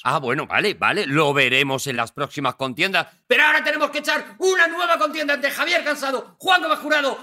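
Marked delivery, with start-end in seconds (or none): 3.3-7.2 high, rising announcer shout; game show announcer. Acted. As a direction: build it up to the big call.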